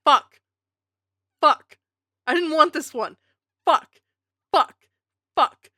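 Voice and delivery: loud repeated cursing; frustrated swearing. Real, not acted. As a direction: curse at the bad roll, loud. Dreaded result on wheel.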